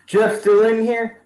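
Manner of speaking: can't believe he's still there; surprised disbelief. Retold or imitated. Fan reacting to Jeff still in the match, stunned high disbelief.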